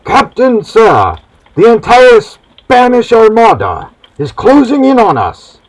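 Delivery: high, loud alarmed call; calling from the mast. Acted. Lookout calling down to the captain about the Armada, loud and high. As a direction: yell the warning down from the mast. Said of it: "Someone from the mast calls out".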